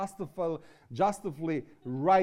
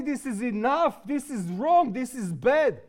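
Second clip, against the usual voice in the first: voiced outrage; 8 semitones higher, 5 dB louder than usual. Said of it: "We gotta say".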